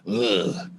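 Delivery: drawn-out low meathead grunt. Retold or imitated. Comedian imitating his gym-going brother, low grunt. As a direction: grunt it low and long, imitating a muscly guy.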